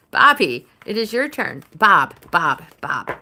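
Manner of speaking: loud call for attention. Real, not acted. Summoning Bob for his turn, loud call. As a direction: call Bob's name across the room. Calling a chat viewer by name.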